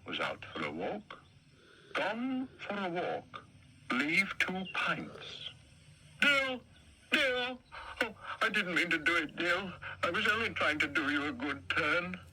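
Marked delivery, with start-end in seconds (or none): 1.9-5.5 drawn-out reading of the note; slow note reading. Acted. The note read again. 6.2-12.2 distraught. Acted. Parsley cries over bones.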